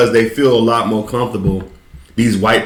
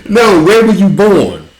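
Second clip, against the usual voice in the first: louder, higher grilling; mock interrogation; 8 semitones higher, 4 dB louder, slower than usual. Questioning a fake Brit's origins, in a loud pushy voice. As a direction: press him like a suspicious host.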